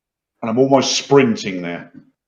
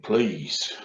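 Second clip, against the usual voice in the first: usual speaking voice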